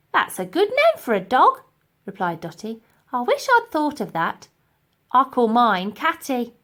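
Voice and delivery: delighted naive Dotty; cheerful Dotty. Acted.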